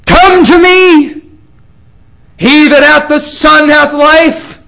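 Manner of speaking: loud, high commanding call of God; voicing the Lord. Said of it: "The Lord says".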